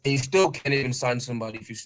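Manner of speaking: ordinary talk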